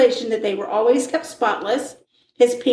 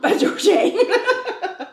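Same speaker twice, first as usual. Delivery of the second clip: slow, high, laughing drawl; 9 semitones higher, much slower than usual. Pretend. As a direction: stretch it out, laughing. Playful sing-song on name.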